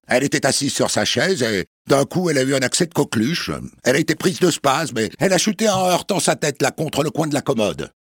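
high, fast nervous excuse; high-pitched excuse. Acted. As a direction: hurry through the lie. Headmistress explains, high.

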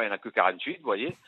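ordinary talk, nothing marked

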